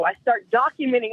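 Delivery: ordinary talk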